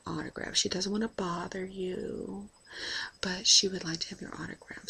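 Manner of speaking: softly whispered, discreet request. Retold or imitated. Flight attendant whispering a passenger's autograph request to the chef. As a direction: hush it so the chef alone hears.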